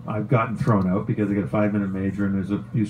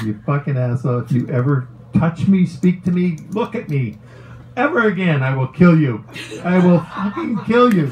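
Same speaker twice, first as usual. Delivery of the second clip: retold furious threat; 7 semitones higher than usual. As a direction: relive the blow-up, climbing into fury. Retold threat to coach Ted.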